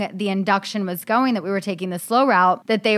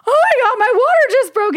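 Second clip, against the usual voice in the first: much higher and louder, alarmed; panicked; 6 dB louder than usual. Retold or imitated. Telling mom the water broke, panicked and high. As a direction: blurt it out in a panic.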